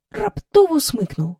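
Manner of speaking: ordinary delivery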